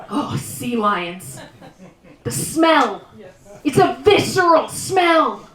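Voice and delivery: ordinary talk, nothing marked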